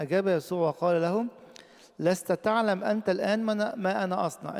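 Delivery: high, loud reading of the verse; preacher crying out the words of Jesus; proclaiming scripture. Acted. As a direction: declare it boldly to the congregation. Preacher reads Jesus' reply.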